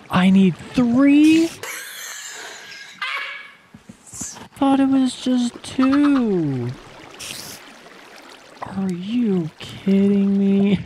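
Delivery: drawn-out high whine of disbelief; high, slow disbelief. Real, not acted. Player learns a bed needs three wools, wailing in disbelief. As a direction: stretch each word in shocked dismay.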